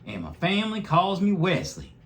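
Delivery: a high little bunny introducing himself; Wesley the bunny. A narrator's reading. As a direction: lift it up into a sweet boy bunny. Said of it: "said Wesley".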